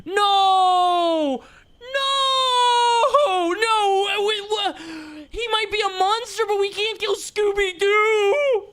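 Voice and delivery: hammed-up anguished shrieking; mock anguished wail. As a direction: howl in hammed-up despair over the dog. Streamer wailing over killing Scooby.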